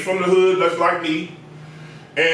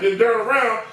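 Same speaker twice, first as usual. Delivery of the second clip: ordinary delivery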